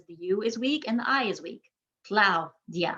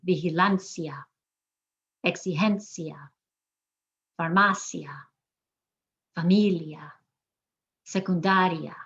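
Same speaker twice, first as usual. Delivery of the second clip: repeat-after-me list, stressing LAN, GEN, MA, MI, DA in "Vigilancia. Exigencia. Farmacia. Familia. Secundaria."; "Vigilancia. Exigencia. Farmacia. Familia. Secundaria." word by word, each final "ia" one sound; second-to-last stress; 2 semitones lower than usual. Shown as a demonstration. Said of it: "we're emphasizing the second to last syllable".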